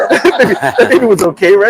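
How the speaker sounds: half-laughing uneasy question. Retold or imitated. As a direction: laugh through the worried words. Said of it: "you're telling your buddy".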